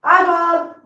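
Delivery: loud high call across the room. Real, not acted. Owner calling for her missing cat Oswald, loud high call. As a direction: call the cat's name to find him.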